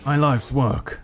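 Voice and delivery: villain voice. Acted. Villain continues speaking.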